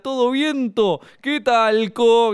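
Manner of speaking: a corny uncle at the door; the joker arriving at your house, high and slow; cheesy old joker. Retold or imitated. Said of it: "este personaje".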